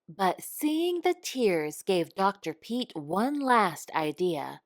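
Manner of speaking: ordinary delivery, nothing marked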